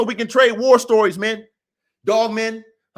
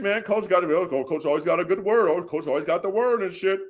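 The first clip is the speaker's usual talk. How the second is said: imitating dismissive fans, low and offhand; mock admirers' chatter; mocking quote; 5 semitones lower, 4 dB quieter than usual. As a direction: toss off their praise in a lower, softer voice. Mimics listeners praising the coach.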